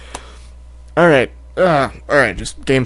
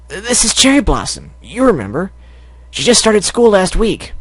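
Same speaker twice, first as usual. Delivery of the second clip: higher filly voice; 7 semitones higher than usual. Acted. Scootaloo introducing Cherry, higher voice.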